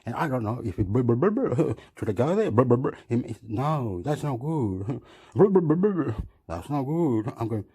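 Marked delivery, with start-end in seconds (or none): 0.9-1.7 trilled lip-buzz "brr"; imitated babble. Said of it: "he's saying". 2.5-3.0 trilled lip-buzz "brr"; imitated babble. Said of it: "he's saying". 5.3-6.1 higher, louder trilled "brr"; imitated babble. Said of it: "he's saying".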